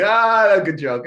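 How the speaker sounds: imitating students, high and loud, brushing it off; mock amused students. Students scoff at the teacher's joke, hammed-up high and loud. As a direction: play the class laughing it off as a gag.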